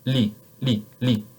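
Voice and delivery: "le" with a light tongue-tip "l"; French L. Shown as a demonstration. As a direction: clear French "le". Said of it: "That's this one".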